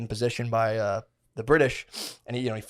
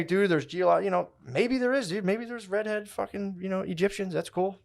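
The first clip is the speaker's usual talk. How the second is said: mocking high voice; 8 semitones higher, 3 dB quieter than usual. Mocking credulous people.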